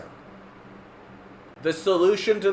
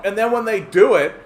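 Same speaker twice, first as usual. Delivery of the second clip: ordinary talk, nothing marked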